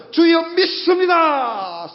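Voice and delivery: drawn-out, high mock congregational shout; imitated revival cry. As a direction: call it out slowly like a revival response. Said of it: "소리치라고 옛날에 하도 그래가지고". (부흥회식으로 길게 외치는 흉내)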